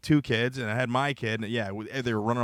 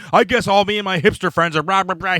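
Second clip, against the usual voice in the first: mocking indignant quote; 5 semitones higher, 6 dB louder than usual. Quoting Miguel's offended hipster post.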